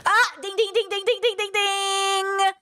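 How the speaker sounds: high sing-song ding ding; mock ding bell. Streamer flags a talking point with a high ding-ding. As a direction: ring it like an alarm bell.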